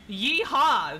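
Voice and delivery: cowboy whoop, loud; loud yeehaw. Acted. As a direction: holler it like a cowboy. Cowboy call.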